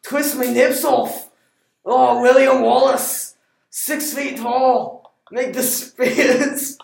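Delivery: loud, high, drawn-out Scottish holler; over-the-top shouted Scottish accent; hammed-up Scottish accent. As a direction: bellow it slowly in broad Scottish. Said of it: "can do my best Scottish".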